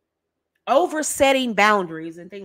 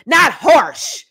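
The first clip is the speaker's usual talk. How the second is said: mock harsh bark, louder and higher; 4 semitones higher, 5 dB louder than usual. Shown as a demonstration. Coach showing wives a harsh barking tone. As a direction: bark it like a nag. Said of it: "Talking to men like this".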